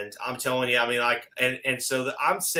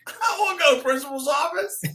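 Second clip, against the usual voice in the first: put-on much higher, fast childish whine; whiny kid; faster than usual. When Don calls him into the office, mock childish whine. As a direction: whine like a schoolkid called to the office.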